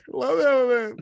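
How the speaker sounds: high, loud mock delight; gushing. Fan gushing over the musical in a high squeal. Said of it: "I'm just like".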